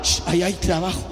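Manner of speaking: a calm reply from above; lightly voiced God. Preacher voicing God answering a lazy believer, low and hushed.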